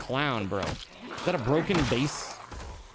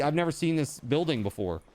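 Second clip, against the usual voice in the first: ordinary delivery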